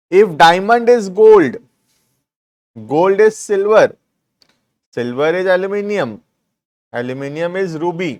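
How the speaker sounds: slow, deliberate dictation to students; teacher dictating word by word. Shown as a demonstration. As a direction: read each clause out slowly for notes. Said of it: "dictating the question slowly".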